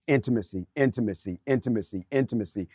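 low, soft, evenly repeated word; same falling tune on each soft repeat; repeated reminder. Acted. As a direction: drum it in quietly, like a mantra. Mimics a constantly repeated reminder.

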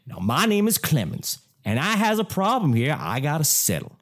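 hammed-up Southern summoning, third go; bad Southern accent. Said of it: "This is the third one".

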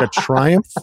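high, questioning mock disbelief; baffled reaction. Moviegoer mocking a grand tagline, baffled. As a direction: query the tagline as if it makes no sense. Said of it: "And you're like, "What?".

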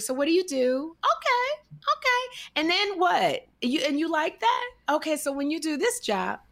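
put-on high girly voice. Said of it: "voice all up".

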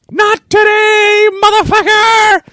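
very high, drawn-out retold yell; heroic battle cry. Friend leaping in from the bus front, long high yell. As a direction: stretch it into a strained high war cry. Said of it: "All I hear is".

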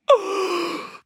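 high, drawn-in gasp of amazement; shocked gasp. Acted. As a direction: gasp theatrically at the surprise. Mimes shock at friend's offer.

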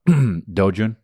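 ordinary delivery

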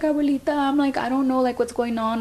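high, pleading call to abuelita; a grandchild begging grandma for help. Retold or imitated. Calling grandma on the phone, high and pleading. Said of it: "and I was like".

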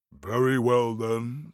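giant voice. Acted. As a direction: rumble it out like a giant. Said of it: "giant voice".

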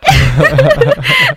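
big, high burst of laughter; loud high laugh. Real, not acted. Host laughing at being called sexy, loud high laugh. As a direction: crack up out loud.

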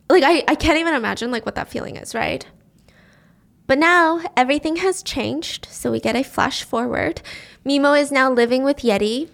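usual speaking voice

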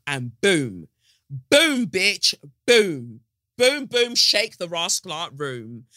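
punchy, loud booms; triumphant exclamation. Acted. God crowing over the big plan, triumphant. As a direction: land each boom like a victory.

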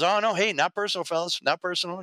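high mocking repeat of not personal; sarcastic echo. Wounded gangster mocking the officers, in a high sarcastic voice. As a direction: throw not personal back at them, high and scornful.

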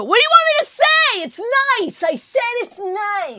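high, loud, hammed-up exasperation; exasperated imitation. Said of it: "And you go".

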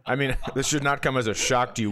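ordinary talk

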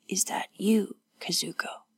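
weak, hoarse near-whisper; hoarse croak. A narrator's reading. Wounded woman asking for her companion, hoarse croak. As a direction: croak it out softly, barely voiced. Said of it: "Her voice was a hoarse croak".

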